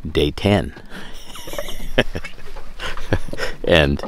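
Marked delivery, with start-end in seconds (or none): 0.8-3.6 long voiceless chuckle; amused laugh, mostly breath. Real, not acted. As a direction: laugh at your own story. Laughs at his own story.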